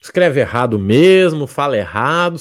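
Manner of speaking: ordinary talk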